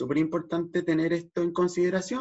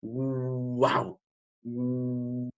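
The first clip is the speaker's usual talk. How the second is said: onomatopeya del perro; 3 semitones lower than usual. Shown as a demonstration. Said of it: "voy a decirle".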